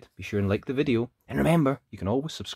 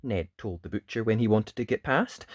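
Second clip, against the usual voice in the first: ordinary delivery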